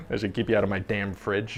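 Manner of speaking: quiet, low aside under the breath; muttered aside. Acted. Host grumbles about his fridge, quiet and low. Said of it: "What was that?".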